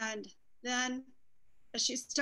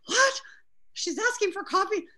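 much higher and louder, amazed; stunned disbelief; 9 semitones higher, 8 dB louder than usual. Retold or imitated. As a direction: nurses who can't believe a dying woman wants coffee. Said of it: "And they're like".

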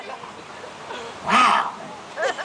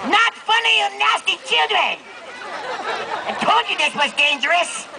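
mock scolding; 8 semitones higher than usual. Mock scolding of laughing crowd.

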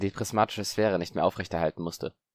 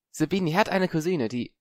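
voiced boy Harry; 9 semitones higher than usual.

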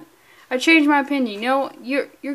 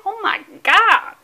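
higher, slow, drawn-out swoon; gushing; 7 semitones higher, 4 dB louder than usual. Acted. Fan swooning at a comic cover, gushing. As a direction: swoon over the artwork.

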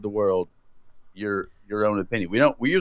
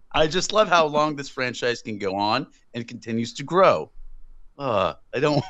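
ordinary delivery

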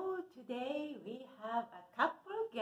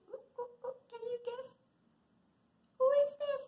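much higher, playful sing-song; teasing guess; 7 dB louder than usual. Acted. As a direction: tease the kids to guess the puppet. Teasing kids about hidden puppet.

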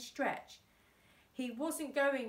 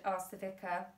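plain reading voice

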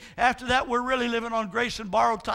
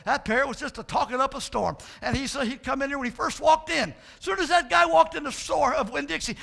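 ordinary delivery

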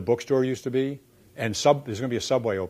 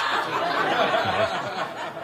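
burst of laughter; 10 semitones higher than usual. Real, not acted. [name] laughing at his own jab about David. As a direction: laugh it off before saying you're kidding.

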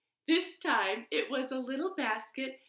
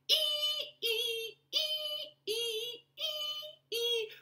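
high sung ee ee; sawing sound; 3 dB quieter, much slower than usual. Shown as a demonstration. Storyteller imitates the saw for children, high and sung. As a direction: sing a high squeaky saw. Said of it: "saw the wood".

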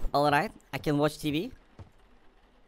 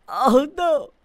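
gasp, then high, loud exclamation; shocked dismay; 7 semitones higher, 8 dB louder than usual. Pretend. Player sees what he did to the cat, shocked dismay. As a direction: gasp and cry out in mock horror.

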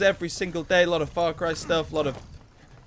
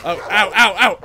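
mock pain yelps; 4 semitones higher, 10 dB louder than usual. Real, not acted. Dog biting in game.

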